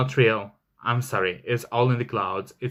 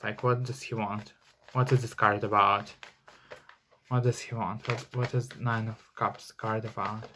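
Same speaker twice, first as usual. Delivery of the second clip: quiet murmur; 7 dB quieter than usual. Real, not acted. Muttering while reading the card.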